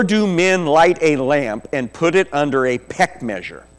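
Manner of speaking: loud, emphatic sermon delivery; full-force preaching. Real, not acted. Preacher expounding Jesus' words to the congregation, loud and emphatic preaching. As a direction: preach it out with punched key words.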